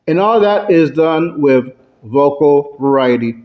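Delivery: ordinary talk, nothing marked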